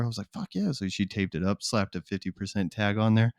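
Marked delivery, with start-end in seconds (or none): 0.3-0.7 excited. Retold or imitated.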